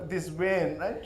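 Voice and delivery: ordinary talk, nothing marked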